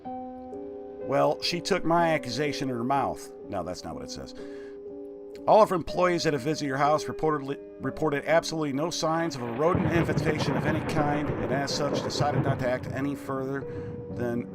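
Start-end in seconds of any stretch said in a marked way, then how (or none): 5.5-14.5 raised, stiff letter-reading voice; formal letter reading. Acted. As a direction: read it like a stuffy complaint letter. Reads letter in game aloud.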